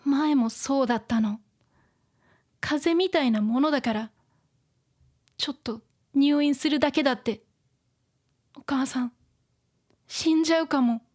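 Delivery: put-on understated anxious child in a story; lightly voiced worried girl. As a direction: confide a fear with mild, acted upset. Reads the worried girl's lines.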